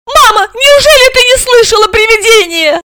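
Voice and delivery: put-on very high, loud child's wail; incredulous little girl, shrill with amazement; astonished. A narrator's reading. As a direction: cry out in disbelief to mum. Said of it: "поразилась Алиса".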